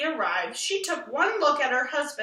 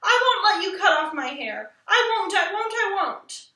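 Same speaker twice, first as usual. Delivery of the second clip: tantrum; 6 semitones higher, 5 dB louder than usual. A narrator's reading. Child tantrum over haircut.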